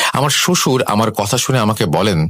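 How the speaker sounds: usual speaking voice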